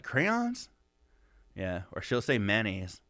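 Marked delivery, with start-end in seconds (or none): 0.0-0.7 high, disbelieving crayons; high shocked correction of crayons; incredulous question. Retold or imitated. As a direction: pitch it up in disbelief. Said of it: "I'm like".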